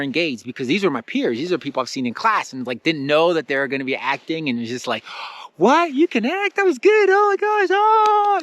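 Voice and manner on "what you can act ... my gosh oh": very high, loud astonished gushing; amazed excitement. Retold or imitated. Student amazed that classmates can act, high excited gushing. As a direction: squeal it, re-enacting delighted shock. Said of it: "So I was excited from that".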